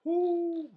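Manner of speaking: high yelp from spicy heat; high pained ooh. Real, not acted. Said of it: "my nose is on fire".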